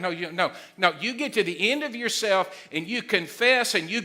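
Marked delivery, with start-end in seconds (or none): none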